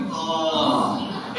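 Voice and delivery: a low, disgusted "ugh", shuddering. Shown as a demonstration. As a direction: shudder as if something vile touched you. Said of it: "like, shuddering".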